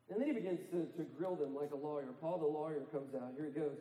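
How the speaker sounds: ordinary talk, nothing marked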